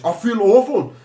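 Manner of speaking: hammed-up Scottish complaint; Scottish accent. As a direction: groan it out in a broad Scottish impression. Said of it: "He's Scottish".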